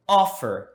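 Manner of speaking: "Offer" with a firm OF and a weaker fer; "Offer" as a teacher's example, OF stressed; stress on first syllable. Shown as a demonstration. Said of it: "a tônica está na primeira sílaba".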